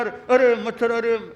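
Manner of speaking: mimicking startled cry. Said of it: "एक मच्छर ने थोड़ा सा किया".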